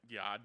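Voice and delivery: "yod" for yard, with no R; dropped R. Shown as a demonstration. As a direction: say "yod" Boston-style, the R left out. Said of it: "they always drop their R's".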